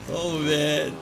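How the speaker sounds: ordinary delivery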